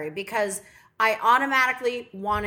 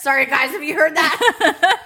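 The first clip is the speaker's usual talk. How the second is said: laughing apology; 5 semitones higher, 9 dB louder than usual. Real, not acted.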